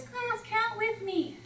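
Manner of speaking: high, slow, stronger call. Retold or imitated. Student leader tries again, high and slow. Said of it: "He says".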